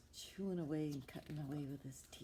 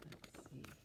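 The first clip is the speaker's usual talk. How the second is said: whispered, building suspense; storyteller whisper; 13 dB quieter than usual. Acted. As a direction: hush it like a secret to children. Suspense while reading to kids.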